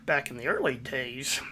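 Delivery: ordinary delivery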